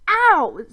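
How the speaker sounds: high, loud yelp of pain; sudden cry of hurt; sharp pained ow. A narrator's reading. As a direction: yelp as if bitten. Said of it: "cried out in pain".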